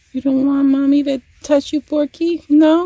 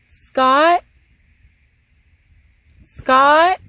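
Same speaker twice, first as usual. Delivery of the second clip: louder call with a rise; 4 semitones higher, 6 dB louder than usual. Real, not acted. Wife calling husband to come help, louder call. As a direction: call him from the next room.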